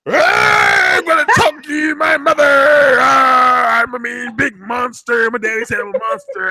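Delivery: high, loud hammed-up shouting; over-the-top fake crying threats; mock monster rage. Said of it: "Olivia's like".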